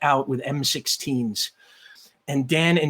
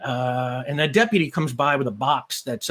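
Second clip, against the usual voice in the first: ordinary talk, nothing marked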